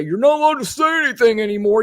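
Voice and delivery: high, fast parroting of a complainer; mock complaint. Fed-up host parrots people claiming censorship, mock complaint. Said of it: "one more person say".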